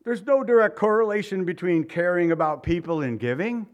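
a sudden high, squeaky protest; high mock protest at the start, then back to normal; mock objection. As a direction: play the indignant churchgoer. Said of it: "We might say".

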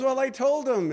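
high, loud imitation of a woman's protest; worked-up caller. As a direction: blurt her reply high and loud, for laughs. Retold reply of the worked-up caller.

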